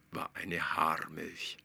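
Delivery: ordinary delivery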